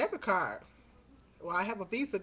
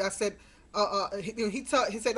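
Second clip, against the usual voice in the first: usual speaking voice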